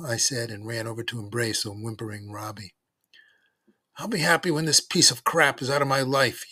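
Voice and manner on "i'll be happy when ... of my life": loud, raised, exasperated voice; angry yell. A narrator's reading. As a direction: yell it in fury, pitched up. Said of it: "yelled Gene".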